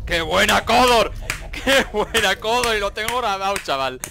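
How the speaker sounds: loud, thrilled cheering; excited shouting. Real, not acted. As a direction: yell with delight at a lucky play. Celebrating a teammate's lucky kill.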